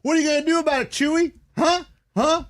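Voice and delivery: high, taunting mock yell; imitated yelling. Uber driver daring the trucker through the window, mock yelling. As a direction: goad him like a driver yelling through glass. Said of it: "yelling through the window".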